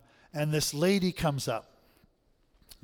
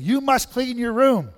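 much higher and louder, a bossy command; mock stern parent; 9 semitones higher, 11 dB louder than usual. Preacher plays a parent ordering a kid, mock stern shout. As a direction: bark the order like a fed-up parent.

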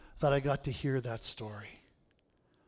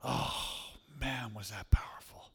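a stunned, whispered aside; awed whisper; 5 semitones lower, 5 dB quieter than usual. Real, not acted. Preacher whispers his awe at the story, in a hush. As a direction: breathe it out in a hushed whisper.

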